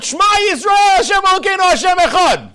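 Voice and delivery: yelled prayer. Retold or imitated. Friend crushed by the snake, yelling the Shema. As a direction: shout the prayer as if facing death. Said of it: "And he just yells out".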